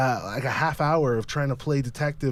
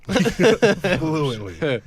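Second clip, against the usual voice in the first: laughing; 5 semitones higher, 6 dB louder than usual. Real, not acted. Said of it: "I speak drunk".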